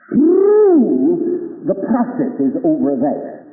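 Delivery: drawn-out solemn proclamation; slow, drawn out. Acted. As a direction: stretch each word out. Slow loud solemn line.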